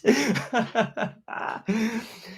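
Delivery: delighted real laughter at the comment; high amused laugh. Real, not acted. Laughing at a viewer's hat donation.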